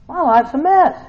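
high, loud cry of misery; loud lament. Acted. Believer telling the Lord his life is a mess, high and loud. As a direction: wail it out.

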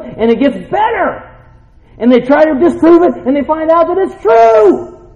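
high, loud, rising preacher's fervour; full-force preaching. Real, not acted. Preacher defending the tested word to the church, full force. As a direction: build to a triumphant cry.

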